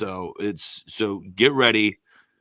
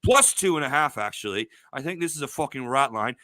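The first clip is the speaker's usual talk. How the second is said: put-on British accent; 3 semitones higher than usual. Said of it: "Joseph and I can talk a British accents".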